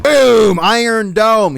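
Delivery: loud, high celebratory shout; mock triumph. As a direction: hype up the mock knockout. Mock celebrating a deflected accusation.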